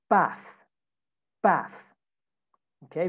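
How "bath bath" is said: "Bath" ending in an F sound. Shown as a demonstration. Teacher shows students the easier sound, "bath" ending in F. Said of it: "sesini F ile değiştirebilirsiniz".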